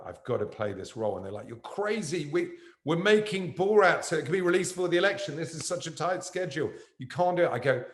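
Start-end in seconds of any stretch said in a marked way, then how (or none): 1.5-7.5 high-pitched imitation of a panicking team; mock alarmed protest. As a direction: protest in a raised, agitated voice. Voices the team's alarmed objection.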